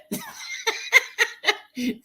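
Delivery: high-pitched giggling fit; real laughter. Real, not acted. Amused by the cash app sound.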